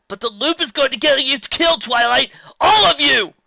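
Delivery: high, loud desperate yell. A narrator's reading. Spike yelling at Twilight about the loop. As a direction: scream it at her. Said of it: "he yelled".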